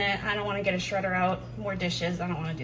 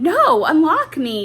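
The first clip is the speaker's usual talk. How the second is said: higher, louder, slow mock plea; complaining oven voice; 8 semitones higher, 13 dB louder, slower than usual. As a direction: whine it as the oven begging. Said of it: "Your oven's going".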